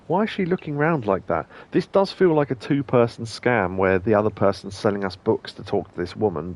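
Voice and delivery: normal talking voice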